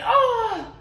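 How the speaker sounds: high, hammed-up death cries; dramatic dying Hamlet. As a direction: moan theatrically as if mortally poisoned. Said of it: "it's really dramatic and terrible".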